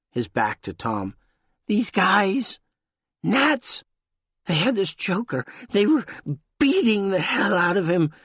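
high, thin and shaken; a scared deformed man pleading; thin frightened voice. A narrator's reading. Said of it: "thin, rustling voice".

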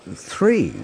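high, hesitant answer. A narrator's reading. Man accepting a drink from an unseen host, hesitantly. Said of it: "Han said hesitantly".

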